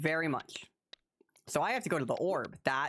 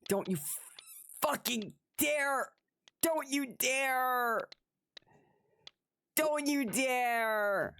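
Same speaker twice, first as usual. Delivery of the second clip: drawn-out threat; 7 semitones higher, slower than usual. Real, not acted. Warning the game, drawn out.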